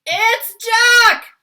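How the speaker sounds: high, full-volume bellow of terror. A narrator's reading. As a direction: bellow like a giant who spots his worst enemy. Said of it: "bellowed the flesh lump eater".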